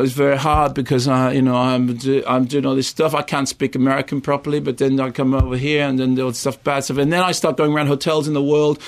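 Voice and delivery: a lightly put-on Belgian accent, whingeing in English; hammed-up Belgian accent with a Kate Winslet in The Reader feel; cod Belgian accent. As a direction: moan it like a self-pitying Belgian film star, in a mock Belgian accent. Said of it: "a cod impression of it in English with a Belgian accent in the manner of Kate Winslet in The Reader".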